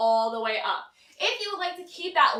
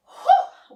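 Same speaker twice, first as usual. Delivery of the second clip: breathless laughing whew; 11 dB louder than usual. Real, not acted. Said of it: "whatever works best for you".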